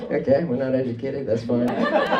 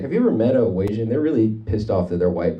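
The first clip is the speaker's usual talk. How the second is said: ordinary delivery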